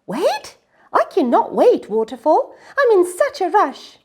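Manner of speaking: high, loud indignant cry; impatient protest. Acted. Miyuki protesting to the waterfall, high and loud. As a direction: bounce up in disbelief.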